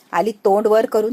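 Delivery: plain reading voice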